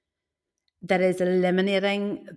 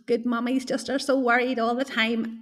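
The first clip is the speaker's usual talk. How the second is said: lightly voiced fretful mother; a hint of the long-suffering mummy; martyr mummy voice; 5 semitones higher than usual. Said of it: "I hear that voice in my head".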